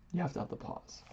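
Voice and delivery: ordinary delivery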